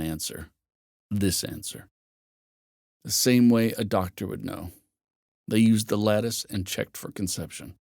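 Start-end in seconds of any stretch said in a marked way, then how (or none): none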